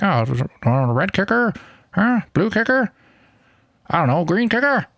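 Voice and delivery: silly put-on voice. Instructor jokingly pitching kicker colors to himself, silly put-on voice. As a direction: toss out each option in a daft high voice. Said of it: "why I'm talking like this".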